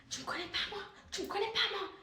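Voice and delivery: high-pitched mock voice of the aggressor; aggressive woman's voice. (voix haute moqueuse de l'agresseuse)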